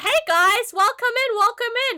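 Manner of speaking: high, loud streamer hello; mock streamer greeting. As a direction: greet chat while slurping, high. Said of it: "and be like".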